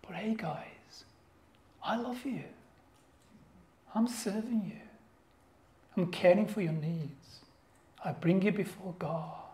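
soft, near-whispered reassurance; a hushed, caring apostle speaking to his church; hushed tender words. Retold or imitated. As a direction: lean in and speak it gently, barely voiced.

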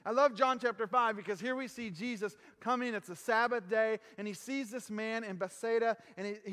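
usual speaking voice

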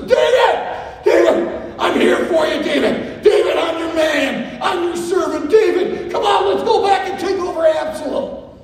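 high, loud, excited calls; eager shouting Hushai. Acted. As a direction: bound in like an overeager dog, shouting. Hushai shouting loyalty at David.

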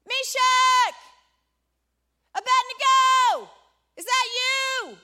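loud, high-pitched calling out; shout. Acted. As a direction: shout each name separately, as if into a roaring furnace. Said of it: "and he shouts".

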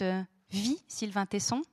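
normal talking voice

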